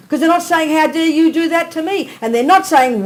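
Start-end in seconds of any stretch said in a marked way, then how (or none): 0.7-2.1 raised, mock-outraged "how dare you"; a hint of a hammed-up indignant victim; indignant protest. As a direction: voice the wronged victim's outrage, higher and louder. Nuns not saying indignant protest.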